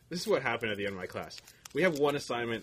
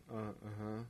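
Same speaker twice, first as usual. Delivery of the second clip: soft, noncommittal acknowledgements; giving nothing back; 12 dB quieter, slower than usual. Retold or imitated. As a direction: answer quietly, offering the complainer nothing. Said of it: "I don't give him anything to work with".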